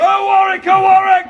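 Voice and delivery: high, loud call to the crowd; rally the crowd at full voice; loud chant leading the crowd; battle cry shout. Acted.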